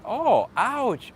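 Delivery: sudden high yelp of surprise; surprised ouch. Real, not acted. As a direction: caught off guard, cry out. Stung by a viewer's joke.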